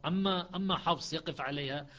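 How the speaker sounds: normal talking voice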